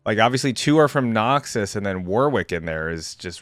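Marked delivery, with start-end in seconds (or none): none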